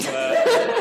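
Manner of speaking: a loud, real laugh breaking out mid-sentence; loud amused laugh; burst of laughter. Real, not acted. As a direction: laugh out loud at your own joke. Laughing at own Rasputin joke.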